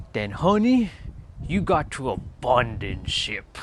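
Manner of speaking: lightly imitated Caribbean accent, slow and sassy; Caribbean accent imitation. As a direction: mimic the woman's Caribbean accent, drawn out. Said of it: "Her Caribbean accent".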